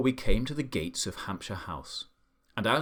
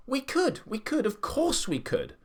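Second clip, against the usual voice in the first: high-pitched, excited clamour; eager shout; 13 semitones higher than usual. A narrator's reading. Team accepts Duke's apple job, excited shout. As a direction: pipe up high, falling over yourselves to agree. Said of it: "we all shouted".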